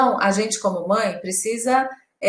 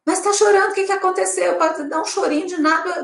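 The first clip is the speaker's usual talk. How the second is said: anxious parent; 8 semitones higher than usual. Retold or imitated. Mother voicing anxious parent.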